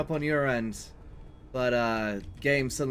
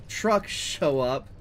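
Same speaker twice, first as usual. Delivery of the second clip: usual speaking voice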